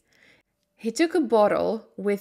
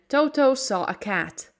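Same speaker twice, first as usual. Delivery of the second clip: ordinary delivery, nothing marked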